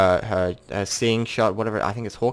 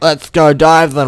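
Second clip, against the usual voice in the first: eager call; 7 semitones higher, 10 dB louder, slower than usual. Retold or imitated. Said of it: "And Tyker's like".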